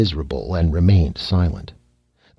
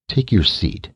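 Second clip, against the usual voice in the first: edged gentleness. Acted. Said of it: "gentleness ... an easily recognized edge".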